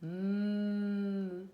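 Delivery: "m" as one long steady hum; letter sound demo. Shown as a demonstration. Teacher asking which letter makes this sound, sustained hum. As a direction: sustain "m" with closed lips, on one note. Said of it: "Qui est-ce qui fait".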